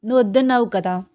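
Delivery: ordinary delivery, nothing marked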